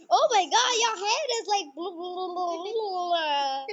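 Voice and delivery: loud, high mock-shocked babble; excited teasing. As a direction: tease a friend's silly look. Mocking the other's head.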